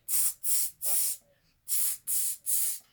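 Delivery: soft hissed tsks in two bursts; monkey "tss" chatter. A narrator's reading. Monkeys answer the peddler from the branches, soft hissing. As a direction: imitate monkeys with quiet unvoiced hisses. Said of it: "they said".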